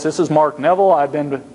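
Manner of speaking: mock being tremendously professional; put-on professional voice. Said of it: "I'm gonna give a different voice".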